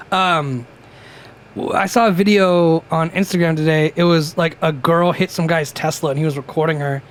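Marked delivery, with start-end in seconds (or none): none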